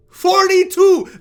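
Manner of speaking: high, loud, drawn-out exclamation; outraged disbelief. Acted. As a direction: shout the age, stretching it out in disbelief. Outraged repeat of her age.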